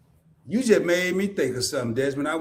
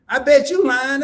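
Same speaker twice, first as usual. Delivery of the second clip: teasing accusation; 7 semitones higher, 5 dB louder than usual. Retold or imitated. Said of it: "And she said".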